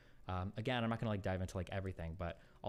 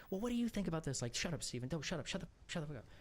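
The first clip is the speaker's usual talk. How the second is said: high, shrill-pitched imitation of an angry partner shouting shut up; mock angry yell; 8 semitones higher than usual. Retelling ex partner telling him to shut up, mock angry yell. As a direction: squeak it out like a furious partner cutting him off.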